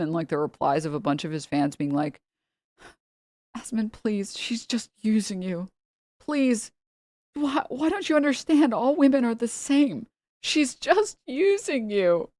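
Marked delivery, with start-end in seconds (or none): 3.6-4.4 whispered mock plea to Asmon; breathy pleading fan imitation. Mocking a fan, a whispered plea. Mocking fans' whiny replies. 4.4-12.3 higher, pleading mock fan voice; whiny fan. Mocking fans begging Asmon, whiny and high.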